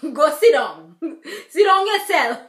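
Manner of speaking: loud, laughing go sit down; laughing scold. Real, not acted. Telling Bobby to sit down while cracking up. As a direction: scold through bursts of laughter.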